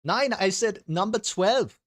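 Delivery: put-on high, slow, exasperated German accent; Thomas Tuchel. Manager correcting the official's board number, high German accent. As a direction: correct him in a drawn-out mock-German voice. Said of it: "Is anyone good at a German accent".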